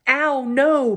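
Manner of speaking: loud, high pained protest; outraged yelp; bellow. A narrator's reading. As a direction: bellow it in pain and annoyance. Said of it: "bellowed Trumpkin".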